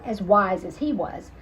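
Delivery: ordinary delivery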